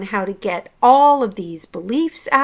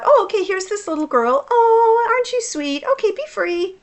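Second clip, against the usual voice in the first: higher, sing-song cooing to a little one; sweet to a child; 11 semitones higher, faster than usual. Retold or imitated. Speaking to the little girl found in her heart, lightly cooing and higher. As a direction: coo it like greeting a small girl. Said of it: "and I just thought".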